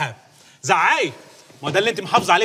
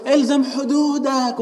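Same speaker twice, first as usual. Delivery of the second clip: mock stern warning; 9 semitones higher, 3 dB louder, much slower than usual. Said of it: "بتسمعيها تقول".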